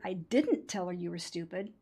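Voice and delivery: emphatic denial on the word "didn't"; stress on DIDN'T. Shown as a demonstration. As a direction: lean firmly on "didn't". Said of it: "emphasize the second word".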